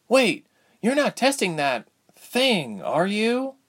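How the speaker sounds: put-on high, worried girlish call, drawn out; timid call. A narrator's reading. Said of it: "Ilse called, following him timidly".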